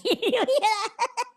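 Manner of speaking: laughing high and slow. Real, not acted. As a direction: crack up through it. Laughing at clip.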